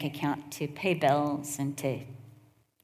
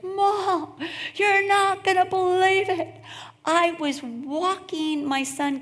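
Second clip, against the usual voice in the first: much higher, louder, laughing news; excited daughter; 12 semitones higher, 5 dB louder, slower than usual. Retold or imitated. Daughter on the phone with big news, high laughing excitement. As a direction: gush like a thrilled daughter. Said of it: "But she's like".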